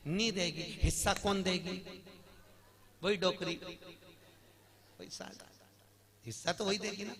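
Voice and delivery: ordinary delivery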